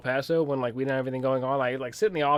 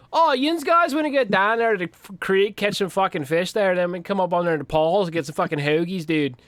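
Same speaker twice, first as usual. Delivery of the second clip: Western PA accent; 4 semitones higher than usual. Shown as a demonstration. Sergeant shows the colonel his accent, thick Western PA yinzer. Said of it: "I got a fucking accent I could turn on and off".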